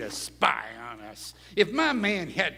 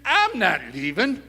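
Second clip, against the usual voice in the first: normal talking voice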